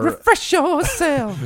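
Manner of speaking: high, slow drawn-out goofy voice; silly high voice. Pretend. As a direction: ham it up in a squeaky voice. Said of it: "You've got to make him".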